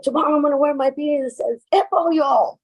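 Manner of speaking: fast, high cocky boast. Retold or imitated. Speaker brags to truck drivers, fast and cocky.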